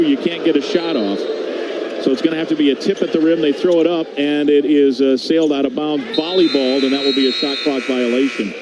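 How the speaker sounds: usual speaking voice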